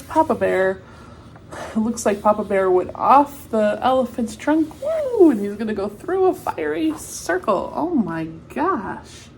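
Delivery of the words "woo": high loud cheer; excited whoop. Real, not acted. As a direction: whoop like at a circus. Cheering the circus trick.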